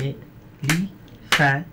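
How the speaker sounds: Elephant drawn out slowly, each syllable separate; syllable clapping. Shown as a demonstration. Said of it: "try कीजिए, चलिए।". (Elephant को धीरे धीरे, syllable तोड़ कर बोलना)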